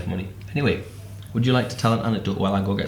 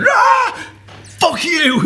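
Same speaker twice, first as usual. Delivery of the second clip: very high, louder yell with a laugh; wild battle cry; 4 dB louder, much slower than usual. Retold or imitated. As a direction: charge in screaming like a warrior. Ashley attacking with foam pies.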